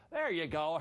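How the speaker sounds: ordinary talk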